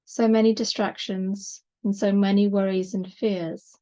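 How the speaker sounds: unhurried yoga relaxation voice with long pauses; measured, calming body-scan delivery; guided meditation. Acted. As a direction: gently guide them into rest, leaving space between phrases.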